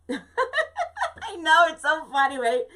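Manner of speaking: high, loud laughing agreement; real giggle breaking into speech. Real, not acted. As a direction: laugh through the words at your own slip. Laughing at own mispronunciation.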